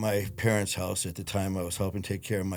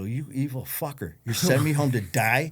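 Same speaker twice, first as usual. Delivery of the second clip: mock outrage; 5 semitones higher, 4 dB louder than usual. Retold outburst at smiling doctor.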